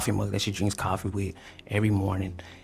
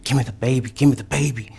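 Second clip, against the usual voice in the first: louder, higher, insistent demand; urgent demand; 3 semitones higher, 6 dB louder than usual. Retold or imitated. Man retelling demanding the baby from girlfriend, loud and urgent. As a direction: demand the baby, pushing harder. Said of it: "And I'm like".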